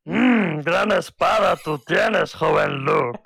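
put-on slow, croaky old Jedi master; Yoda voice. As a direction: drag each word like wise Yoda. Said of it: "en idioma Yoda". (voz de Yoda, lenta)

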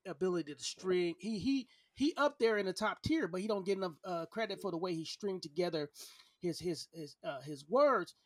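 ordinary talk

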